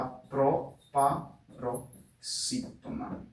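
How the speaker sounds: "proparoxítona" very slowly, split pro-pa-ro-xí-to-na; syllable by syllable. Shown as a demonstration. Teacher introducing a long term to a pupil, "proparoxítona" syllable by syllable. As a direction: spell out "proparoxítona" in spaced syllables. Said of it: "aquele nome bem grande".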